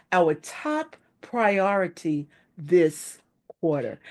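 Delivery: slow, deliberate delivery after a pause. Shown as a demonstration. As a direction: land each word slowly so it sinks in. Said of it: "you slow that down quite a bit".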